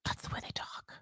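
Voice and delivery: whispered aside; a quick secret whisper to the viewers. Real, not acted. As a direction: drop to a breathy whisper. Aside after a laugh.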